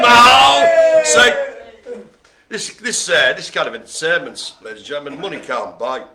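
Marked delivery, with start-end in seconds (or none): 0.0-1.6 high, loud, playful no; mock shout. Host rejecting praise of his wig, mock shout. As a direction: protest for the laugh.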